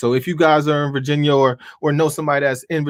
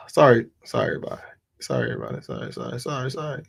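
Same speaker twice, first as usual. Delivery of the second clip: much softer muttered sorries; soft apologies; 6 semitones lower, 5 dB quieter, much faster than usual. Real, not acted. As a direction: mumble apologies into the mic. Apologising for tech glitch.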